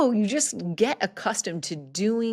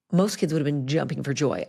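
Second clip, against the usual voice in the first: normal talking voice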